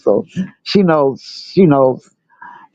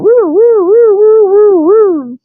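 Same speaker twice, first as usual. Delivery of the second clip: high, loud "woo" siren wails; imitated siren wail; 6 dB louder, much slower than usual. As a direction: mimic a child copying an ambulance siren. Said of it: "I can hear my granddaughter on the side of me going".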